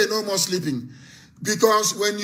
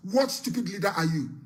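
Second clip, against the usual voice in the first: usual speaking voice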